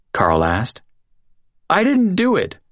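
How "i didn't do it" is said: high, tearful denial; sobbing. A narrator's reading. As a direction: plead through sobs. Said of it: "Green sobbed".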